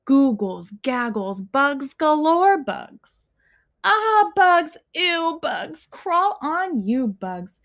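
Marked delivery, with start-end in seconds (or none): none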